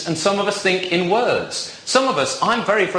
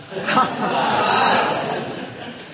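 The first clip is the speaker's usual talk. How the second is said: airy unvoiced chuckle; breathless laugh; 3 semitones higher than usual. Real, not acted. After naming Waitrose.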